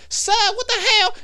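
high, loud outraged cry at the man; mock shocked lady. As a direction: yelp it like she can't believe it. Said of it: "And then she goes".

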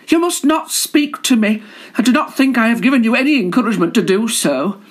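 high, prim ladylike voice, quickening; a shy lady garter primly brushing off a suitor. Acted. Shy garter scolds a flirting collar, high and prim.

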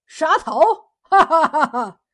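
loud mocking question and hearty laugh; acted defiant guffaw; scornful laugh. A narrator's reading. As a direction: laugh the threat off loudly. Fisherman laughs off threat of beheading.

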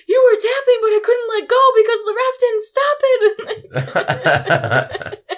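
high and loud, pushed out; retold loud protest. Winning fighter loudly explaining he couldn't release the choke. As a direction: defend yourself at full volume. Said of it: "the guy on top was like".

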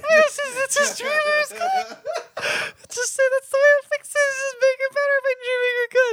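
high, laughing words; laughing through it. Real, not acted. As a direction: squeak it out while cracking up. Laughing while recapping absurd plot.

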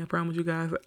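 usual speaking voice

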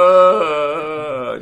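loud, high mock wail of pain; imitated Tarzan wail. Roommate with a migraine wailing across the apartments, mock Tarzan howl. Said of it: "wailing across the whole apartment complex".

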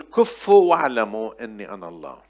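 forceful declaration of a key verse; loud proclamation. Acted. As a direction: hit كفوا loudly, then fall away. (إعلان جهوري حازم)

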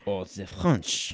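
slow put-on French accent; mock French accent. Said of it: "no more racist than doing the Germans".

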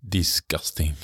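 low, mock Russian accent. Friend judges the uneaten sandwich, mock Russian accent. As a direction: say it like a doubtful stage Russian. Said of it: "It was like a Russian".

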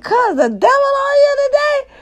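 very high, squealing, mocking question; teasing cousin. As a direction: tease your cousin for crying over the song. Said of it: "He's like".

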